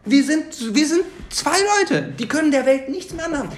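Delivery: high, gleeful and defiant; gleeful defiance. Real, not acted. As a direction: crow it out, triumphant. Gleeful Nein/Doch bickering. (übermütig trotzig)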